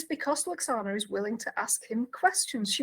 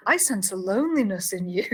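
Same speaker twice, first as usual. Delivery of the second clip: ordinary talk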